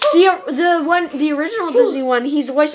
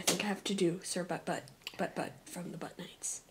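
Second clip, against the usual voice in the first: whisper; 9 semitones lower, 22 dB quieter than usual. Real, not acted. Giggling aside.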